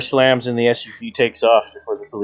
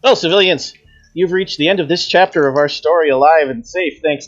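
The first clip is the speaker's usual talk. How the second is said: announcer voice; 3 semitones higher than usual. Acted. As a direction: address the audience like a host. GM's closing announcement to viewers.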